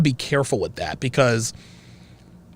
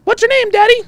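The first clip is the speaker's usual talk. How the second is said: put-on child's voice, much higher and louder; childish high voice; 8 dB louder than usual. A kid asks Daddy his name, high and piping. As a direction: pipe it up like a little kid. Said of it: "I call him Zed".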